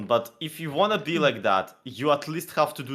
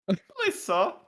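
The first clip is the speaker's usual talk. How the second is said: higher pleading orphan voice; Oliver Twist; 12 semitones higher than usual. Pretend. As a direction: beg meekly like a hungry urchin. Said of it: "Oh, Oliver Twist".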